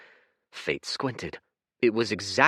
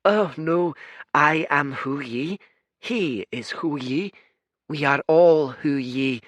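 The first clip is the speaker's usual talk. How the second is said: higher but gentle, calm and apologetic; soft kind voice; 8 semitones higher, 6 dB louder than usual. Acted. Kind blue twin apologizing to Fate, soft gentle voice. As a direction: soothe the guest. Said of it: "The blue one laughed softly".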